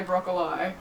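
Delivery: put-on old people's "broccoli", ending in "lie". Host quoting old people, "broccoli" as brocco-lie. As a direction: say "broccoli" with a final "eye" sound. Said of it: "Old people say broccoli".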